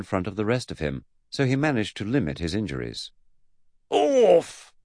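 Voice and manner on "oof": loud, high "oof" on impact; sudden grunt of walking into something; pained oof. Acted. Man walks into a door, loud pained oof. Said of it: "the universal noise a person makes".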